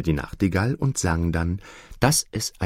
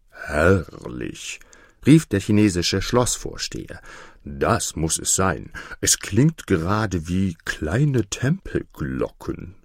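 lower, slow pompous voice; enraptured castle steward; 3 semitones lower than usual. Acted. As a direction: gush over it like a pompous official. Castle steward raves about frogs.

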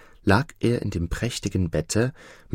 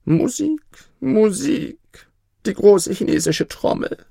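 much higher, louder frantic cry for music; panicked emperor calling for music; 6 dB louder than usual. Acted. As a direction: cry out desperately for the drum. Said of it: "rief er".

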